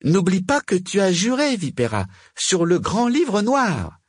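high-pitched witch issuing a reminder; Margot. A narrator's reading. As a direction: hold the rival to her oath, pitched high.

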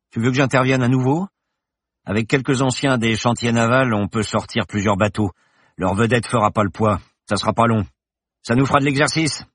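lightly voiced boastful thug; a hint of a cocky henchman; Victor. A narrator's reading.

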